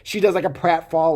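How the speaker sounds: ordinary talk, nothing marked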